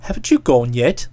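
put-on deep, gruff young man; low male voice. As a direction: drop low for Boko. Boko voiced by the narrator.

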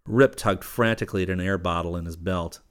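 usual speaking voice

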